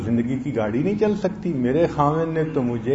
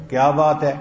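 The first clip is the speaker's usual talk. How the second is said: quick, louder, demonstrated annoyance; slightly angry; 5 dB louder than usual. Shown as a demonstration. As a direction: say it short and loud, a bit cross. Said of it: "اور اگر ذرا غصے میں".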